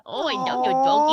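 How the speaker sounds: gushing at a cute dog; cooing to a dog. Real, not acted. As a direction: coo over the puppy.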